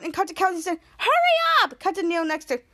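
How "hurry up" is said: high, shrill-free hurry shout, much higher; urgent call. Retold or imitated. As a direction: yell it to hurry everyone along. Kelsey urges them to leave.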